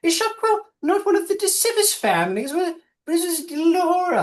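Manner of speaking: put-on a fluttery, slightly senile old matriarch; old gnome woman. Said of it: "I goes".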